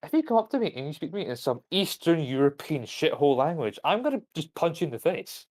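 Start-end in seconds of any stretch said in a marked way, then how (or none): none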